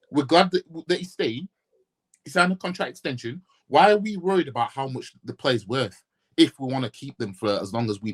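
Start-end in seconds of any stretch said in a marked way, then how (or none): none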